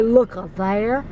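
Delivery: slow, drawn-out Southern "Look up there"; slow Southern drawl on "Look up there". Shown as a demonstration. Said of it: "the way I talk".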